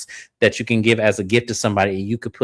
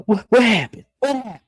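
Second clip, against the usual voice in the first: imitating Latrice; 10 semitones higher than usual. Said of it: "Latrice walked up to Glenn".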